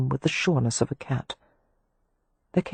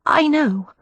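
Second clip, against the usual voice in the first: anxious; 12 semitones higher, 4 dB louder than usual. A narrator's reading. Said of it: "Poppy said anxiously".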